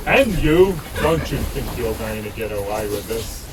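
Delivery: booming warrior king. Acted. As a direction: thunder the demand down from the throne. King demands sacrifice.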